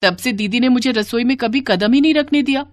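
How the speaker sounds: high-pitched daughter, startled then confiding; bewildered daughter. Acted. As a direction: pour out your puzzlement to mother. (ऊँची आवाज़ में हैरान बेटी)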